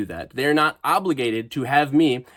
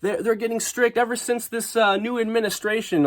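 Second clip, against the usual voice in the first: mocking whiny voice; 9 semitones higher than usual. Mocking foreigners' posts.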